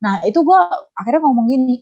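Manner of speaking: ordinary talk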